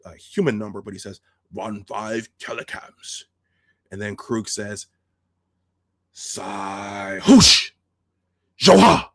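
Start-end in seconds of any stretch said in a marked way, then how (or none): 1.5-3.2 slow, softer Klingon range call; measured alien countdown, words drawn out; Maltz. Retold or imitated. Maltz reports the range, slow and quiet Klingon voice. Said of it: "That's the way it sounds". 6.1-9.1 drawn-out start bursting into a loud shout; fierce Klingon command. Retold or imitated. Kroog orders his crew, fierce shouting.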